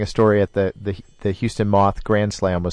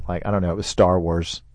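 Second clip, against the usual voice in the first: ordinary delivery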